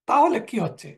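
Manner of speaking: normal talking voice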